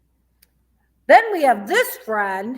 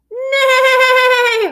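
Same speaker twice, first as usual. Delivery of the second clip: horse neigh; 10 dB louder than usual. Shown as a demonstration. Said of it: "What kind of animals".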